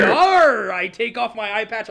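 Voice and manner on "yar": hearty shouted pirate yar; loud pirate yar. Pretend. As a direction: bellow it like a pirate. Pirate roleplay call.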